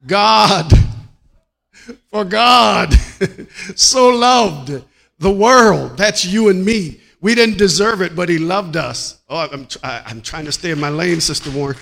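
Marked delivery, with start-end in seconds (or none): 0.1-9.2 loud, high preaching, dragging out so loved; full-force preaching. Acted. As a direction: preach it to the congregation.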